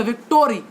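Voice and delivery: "विक्टोरी" as vik-TOH-ree with an added vowel; mispronounced victory. Shown as a demonstration. Teacher repeating the student's wrong word, "विक्टोरी" with added "toh". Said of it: "ये शब्द ठीक नहीं है".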